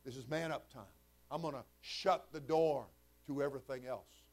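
ordinary talk

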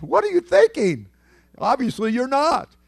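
mock the sinner's foolishness; incredulous rebuke.

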